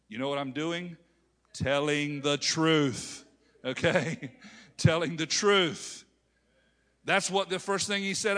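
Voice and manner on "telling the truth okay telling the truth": slow and louder, each word weighted; slow emphatic declaration. Real, not acted. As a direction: drive the point home. Preacher emphasizing point.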